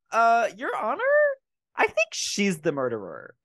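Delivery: high, hesitant mock juror; imitating nervous jurors. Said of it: "those bitches would be like".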